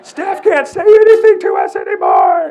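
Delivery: screaming high and loud, slowed; mock kid yelling in triumph; triumphant shout. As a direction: crow it out like a wild kid. Boys rebelling, shouting.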